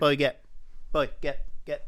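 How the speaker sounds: loud, sharp order to a dog; firm command. Real, not acted. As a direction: shoo the dog off firmly.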